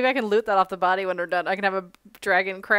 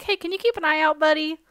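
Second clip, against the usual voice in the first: character voice; 6 semitones higher than usual. Acted. Character asks NPC for help.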